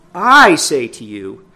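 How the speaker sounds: slow, weighty I say to you; emphatic I say. Shown as a demonstration. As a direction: lean hard on I, slowly. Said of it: "when he emphasizes that the way he does".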